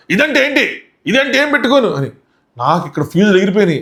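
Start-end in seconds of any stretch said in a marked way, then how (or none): 0.1-1.9 imitating a yell. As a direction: throw his shout back in a high strained voice. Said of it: "Nookaraju గట్టిగా అరుస్తా". (నూకరాజు అరుపును ఎత్తైన గొంతుతో అనుకరించడం)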